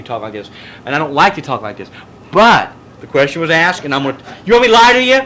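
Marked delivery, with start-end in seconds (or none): 4.4-5.1 challenging. Real, not acted. Challenging the class.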